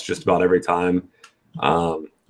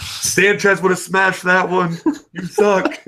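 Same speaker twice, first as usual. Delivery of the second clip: high, slow mock heckler jeer; heckling from crowd; 11 semitones higher, slower than usual. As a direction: taunt the player from the bleachers. Imagined heckling from the stands.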